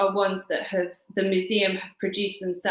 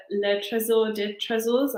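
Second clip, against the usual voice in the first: French accent; 3 semitones higher than usual. Real, not acted. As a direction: say the French title in a self-conscious French accent. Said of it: "my French accent is terrible".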